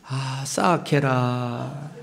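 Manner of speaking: slow, drawn-out Gyeongsang dialect sigh of pity. Shown as a demonstration. Preacher demonstrating Gyeongsang pity word, slow Gyeongsang drawl. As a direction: stretch the Gyeongsang 싸악해라 like a pitying sigh. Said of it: "이 방언이 좀 잘 알아들으세요".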